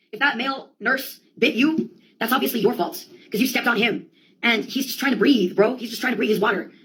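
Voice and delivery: ordinary talk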